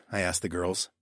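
usual speaking voice

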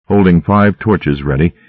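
plain reading voice

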